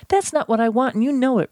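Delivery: high-pitched, testy comeback; irritated retort. A narrator's reading. Irritated patient argues with secretary.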